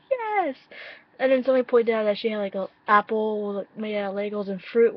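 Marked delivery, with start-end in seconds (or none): 0.1-0.5 much higher, squealed yes; excited squeal. Retold or imitated. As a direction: squeal it like a fangirl. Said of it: "but I was like".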